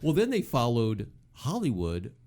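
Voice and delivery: usual speaking voice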